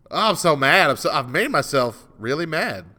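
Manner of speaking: loud, high burst of frustration, easing off; exasperated with yourself; worked-up anger. Real, not acted. Host mad at himself over his hat rant, frustrated. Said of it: "I am so angry right now".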